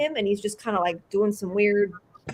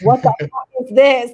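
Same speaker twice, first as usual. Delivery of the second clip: louder, thrilled awe; amazed delight; 4 semitones higher, 8 dB louder than usual. Retold or imitated. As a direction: blurt it out in wonder. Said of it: "And I was like".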